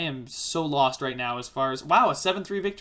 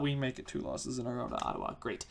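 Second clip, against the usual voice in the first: much quieter, lower, resigned mutter; deflated; 5 semitones lower, 11 dB quieter than usual. Real, not acted. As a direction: drop right down in real disappointment. Dreaded second straight loss comes in.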